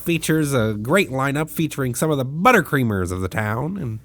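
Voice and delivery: play-by-play like a cheap Vin Scully; mock sportscaster. Friend hamming up the baseball commentator for the podcast. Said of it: "a discount Vin Scully who is commentating this".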